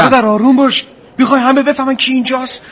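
urgent pleading. Acted. Subordinate begging his angry commander to calm down, urgent. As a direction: cut in urgently to restrain him.

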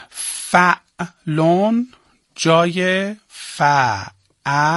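very slow dictation, each syllable drawn out; dictation pace. Shown as a demonstration. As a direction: spell it out slowly for students to write. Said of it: "دو تا شاخه براش باز کن".

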